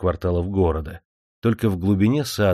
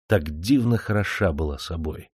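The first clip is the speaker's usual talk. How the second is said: plain reading voice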